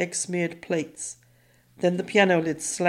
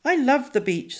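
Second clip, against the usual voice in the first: put-on girl voice; 7 semitones higher than usual. A narrator's reading.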